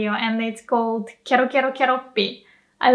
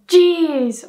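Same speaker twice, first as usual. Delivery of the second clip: exasperated; 6 semitones higher, 6 dB louder than usual. Shown as a demonstration. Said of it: "when you're frustrated".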